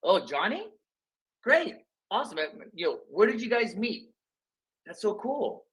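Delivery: high, eager friendly questions; upbeat curious. Pretend. Agent on a call asking about the rival agent, excited. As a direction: sound thrilled to hear about Johnny. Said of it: "be like".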